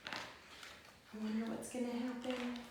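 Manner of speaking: ordinary delivery, nothing marked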